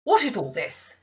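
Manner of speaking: loud, commanding question; peremptory demand. Acted. Mistress arriving to question the servants, peremptory. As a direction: demand it with cold authority. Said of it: "demanded another voice peremptorily".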